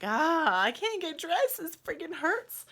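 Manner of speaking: high, loud whining cry of pain; pained wail. Retold or imitated. Labouring mother who can't get dressed, high pained wail. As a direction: whine it loudly through the pain.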